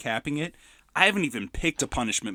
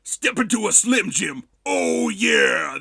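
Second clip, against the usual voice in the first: wrestler promo voice; 4 dB louder, slower than usual. Retold or imitated. Quoting a favorite movie scene to his cohost, in a hammed-up wrestler growl. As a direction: growl the wrestler's catchphrase and bellow oh yeah. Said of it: "That was my favorite part of the movie".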